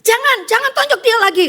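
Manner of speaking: high, loud pleading to stop; urgent shout. Retold or imitated. Passengers shouting to stop the second punch, high and loud. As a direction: shout to hold him back.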